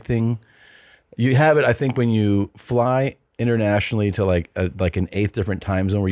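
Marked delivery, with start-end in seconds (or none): none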